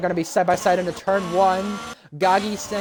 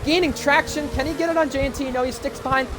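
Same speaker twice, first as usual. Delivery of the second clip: high, excited race call; motorsport commentator; 9 semitones higher than usual. Acted. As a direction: call the overtakes at full energy. Live race play-by-play.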